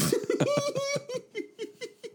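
shrieking giggle fit; high squealing laugh. Real, not acted.

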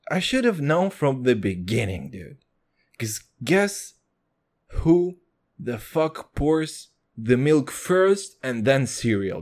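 ordinary talk

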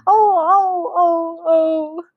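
high, wailing storybook sobs; acted sobbing. A narrator's reading. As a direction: wail each "oh" high, as if sobbing. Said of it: "sobbed Missus Gregg".

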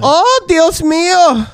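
high, slow, put-on Spanish gasp; mock Spanish exclamation. Reacting to the maid story, high slow Spanish gasp. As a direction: clutch pearls in Spanish.

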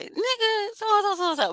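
a high squeal of disbelief at his posts; exasperated call-out. Retold or imitated. Wanting to call out the podcast host, high exasperated squeal. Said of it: "I be wanting to just be like".

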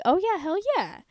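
high, excited agreeing friend; friend's high-pitched hell yeah; eager yes. Retold or imitated. Barry eagerly agrees to call.

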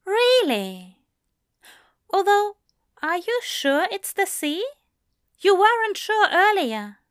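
high, fast, fussy angel voice; Aziraphale voice. Acted.

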